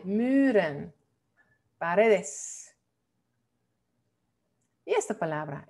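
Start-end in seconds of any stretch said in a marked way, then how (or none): 0.0-0.9 muren with long rounded "ü", "MÜÜ-ren"; correct Dutch version. Shown as a demonstration. Teacher correcting "muren", long Dutch rounded U. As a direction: sound out "muren" with a tight rounded vowel. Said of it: "Porque tenemos una consonante".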